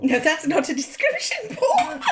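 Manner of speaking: laughing teasing protest. Real, not acted. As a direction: laugh through it with a squeaky rise. Teasing husband while laughing.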